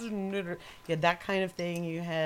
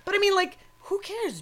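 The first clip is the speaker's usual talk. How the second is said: exasperated outburst; 7 dB louder than usual. Real, not acted. Ranting about paying for parks.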